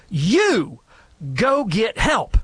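single someone out with a firm, drawn-out instruction; acted emergency order to one person; pointed command. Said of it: "point your finger at them and you go".

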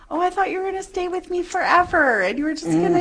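hammed-up lament at kids leaving; clingy parent. Mother to her kids heading off to college, mock sad whine. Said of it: "And it's like".

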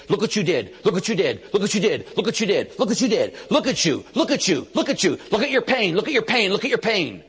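taunting devil. Retold or imitated. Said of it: "the devil ... poking and says".

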